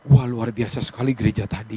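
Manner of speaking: lightly voiced amazed churchgoer; impressed churchgoer. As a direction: play a dazzled worshipper heading home. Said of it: "makanya kita pulang bilang apa?".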